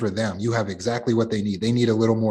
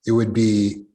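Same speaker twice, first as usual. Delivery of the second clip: usual speaking voice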